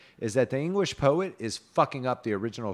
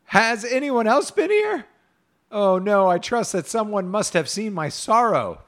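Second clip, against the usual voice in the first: high, loud melodramatic plea; miserable lament; 11 semitones higher, 8 dB louder than usual. A narrator's reading. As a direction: cry out in exaggerated sorrow. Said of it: "He looked up full miserably and said".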